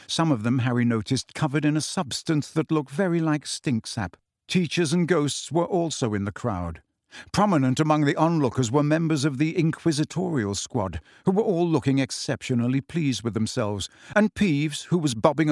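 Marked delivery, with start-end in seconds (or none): none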